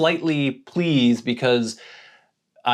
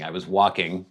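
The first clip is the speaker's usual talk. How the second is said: ordinary delivery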